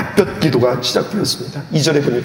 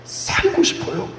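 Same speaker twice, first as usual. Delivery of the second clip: desperate plea; 8 dB quieter than usual. Acted. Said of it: "기도하는 히스기야".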